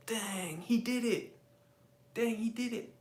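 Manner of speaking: imagined onlooker. Acted. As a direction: react under your breath as an impressed bystander. Imagined viewer reacting to a ban.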